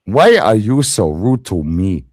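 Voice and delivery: loud, mock African accent; indignant question in a mock African accent. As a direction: belt it out in a put-on African accent. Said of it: "I can do the African thing".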